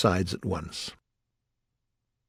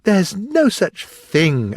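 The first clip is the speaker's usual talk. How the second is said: loud, higher, drawn-out indignation; very angry; 10 semitones higher, 6 dB louder, much slower than usual. A narrator's reading. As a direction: contradict crossly, word by word. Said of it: "Alice answered very angrily".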